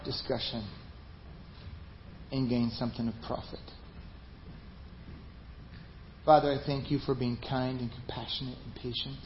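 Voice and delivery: soft, hushed pastor's prayer; quiet prayer. Acted. Pastor closing the sermon with the congregation, in quiet prayer. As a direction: pray gently over the church.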